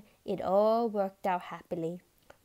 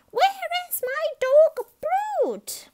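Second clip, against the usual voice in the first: very high, loud frantic yell; 9 dB louder than usual. A narrator's reading. Ratso bursts in searching for his dog, yelling. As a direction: yell across the salon for a lost dog. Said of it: "yelled Ratso".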